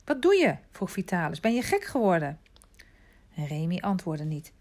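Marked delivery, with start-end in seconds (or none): 0.0-0.6 higher, louder astonished question; startled demand. A narrator's reading. Vitalis questions the boy who is suddenly shouting, startled. 1.4-2.4 higher-pitched, disbelieving reproach; incredulous question. A narrator's reading. Vitalis asks the shouting boy, incredulous.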